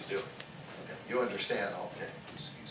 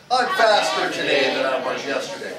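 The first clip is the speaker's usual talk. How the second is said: said out loud, much louder; louder repeat; 11 dB louder than usual. Shown as a demonstration. Instructor leading the class, repeating louder. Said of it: "Say it again".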